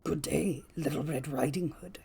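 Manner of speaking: low, soft wolf voice; quiet, low storybook wolf; sly wolf. A narrator's reading. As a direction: greet her as the lurking wolf, hushed and low. Wolf greets the girl.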